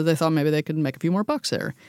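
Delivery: usual speaking voice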